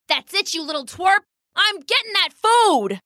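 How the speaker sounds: high, angry bird threat; fed-up pheasant. Acted. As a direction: lose patience, voice rising high. Pheasant loses patience.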